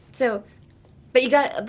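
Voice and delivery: ordinary talk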